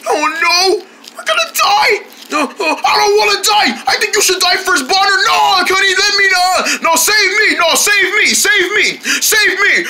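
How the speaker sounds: high-pitched wailing panic; squealing mock terror; panicked pleading. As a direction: beg frantically for your life, hammed-up. Acted panic, begging to be saved.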